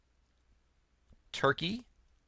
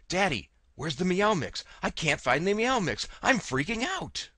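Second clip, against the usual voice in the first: higher, whiny cat begging for Meow Mix; a cat losing it over missing food, climbing higher; panicking cat voice; 7 semitones higher than usual. Pretend. Voicing the cat's thoughts, in mock panic. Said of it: "what Darwin's thoughts were".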